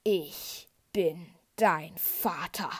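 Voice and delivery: low, very slow, heavy; Vader reveal. A narrator's reading. Vader reveals the truth to Luke, low and slow. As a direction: let each word fall like a verdict. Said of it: "antwortet Vader".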